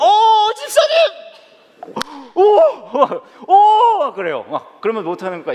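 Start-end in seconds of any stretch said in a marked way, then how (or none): 0.0-0.9 hammed-up high, loud cry of recognition; glad greeting. Pastor greeting a member he can't place, overdone delight. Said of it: "우선은 전 반가워요". 2.3-4.1 loud, high, hammed-up oohs; delighted exclamation. Pastor stalling while greeting a member, exaggerated delight. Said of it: "우선은 전 반가워요".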